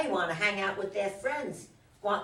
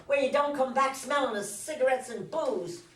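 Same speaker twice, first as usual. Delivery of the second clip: higher, sharp and scornful; heated retort; 6 semitones higher, 3 dB louder than usual. Acted. Wife scolds husband about drinking, higher and scornful. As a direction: throw it back at him with scorn.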